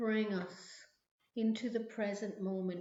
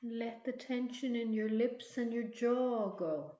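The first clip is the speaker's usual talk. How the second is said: guided meditation. Acted. Leads a relaxation visualization.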